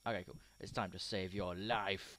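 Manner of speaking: normal talking voice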